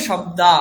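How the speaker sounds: শব্দা with a loud open আ and no final h; Bengali pronunciation. Shown as a demonstration. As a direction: end শব্দা on the bare vowel. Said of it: "বাংলাতে আমি কি বলবো?". (বাংলা ধাঁচে শব্দা, বিসর্গ ছাড়া)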